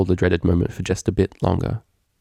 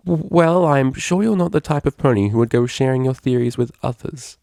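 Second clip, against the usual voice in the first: lightly voiced flustered mare, stumbling on the first word; a hint of nervous pleading, stammered start; 6 semitones higher than usual. Octavia, caught out, nervously asking Bon Bon for secrecy.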